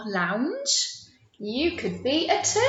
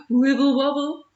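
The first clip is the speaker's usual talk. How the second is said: wobbly voice; 3 semitones higher, 4 dB louder than usual. Shown as a demonstration. Wobbling like jellyfish.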